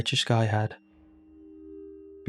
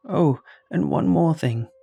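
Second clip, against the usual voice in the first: put-on higher-pitched old-lady voice; old lady's afterthought, pitched up; 6 semitones higher, 4 dB louder than usual. A narrator's reading. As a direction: chime in like an elderly shopper. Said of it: "She nodded and continued".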